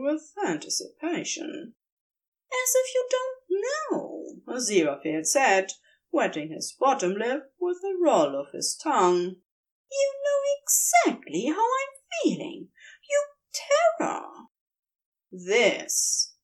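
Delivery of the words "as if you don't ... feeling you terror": higher, fond, teasing angel voice; adoring husband teasing back. A narrator's reading. Aziraphale voiced in narration.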